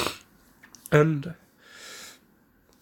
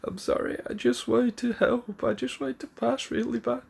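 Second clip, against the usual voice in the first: high, tearful, breaking voice; a boy sobbing out his apology; crying apology; 7 semitones higher than usual. Acted. Naruto crying to his teacher, high tearful pleading. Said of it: "starts crying into him".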